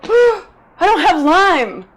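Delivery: dawning oh-no moment; panicked realization. Retold or imitated. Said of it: "I was like".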